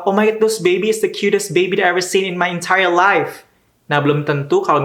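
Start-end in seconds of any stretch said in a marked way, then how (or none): 0.0-3.5 higher, hammed-up gushing over a baby; over-the-top American gushing. A stranger praising a parent's baby, hammed-up gushing.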